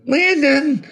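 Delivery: very high, loud scream; shrieked little-boy call; child screaming. A narrator's reading. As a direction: scream the name like a desperate boy. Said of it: "I heard him scream this".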